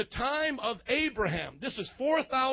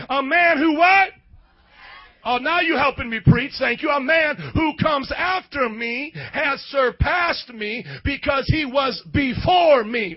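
fiery sermon preaching; 6 dB louder than usual. Acted. Preacher reading scripture loudly to the church. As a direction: preach it on fire.

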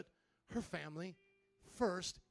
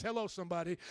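ordinary delivery

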